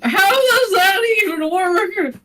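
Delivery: high, crying-out disbelief; excited shout. Real, not acted. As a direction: yelp it out in mock despair. Wild reaction at the table.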